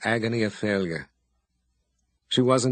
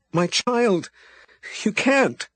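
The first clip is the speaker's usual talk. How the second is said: high, louder anguished cry; desperate outcry; 10 semitones higher, 3 dB louder, slower than usual. A narrator's reading. Abby cries out to the departing wizard, desperately. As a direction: cry out for your child.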